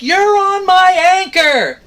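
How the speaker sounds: high, slow, strained mock yell; calling out hard across the water, slowly; retold yell. Yelling at shrimp boat captain parked on anchor, a retold high slow yell. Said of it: "I scream at him".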